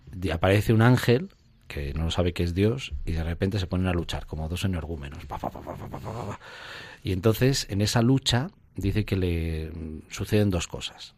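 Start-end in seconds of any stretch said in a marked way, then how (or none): 5.3-6.4 soft breathy pa pa pa blows; imitated scuffle noise. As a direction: tap out the struggle under the breath. Mimicking Jacob wrestling the angel. (golpes imitados en voz baja)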